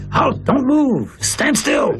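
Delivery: high-pitched shouted command; imitated guards' shouting. Guards with machine guns halting people in the yard, shouting. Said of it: "were shouting".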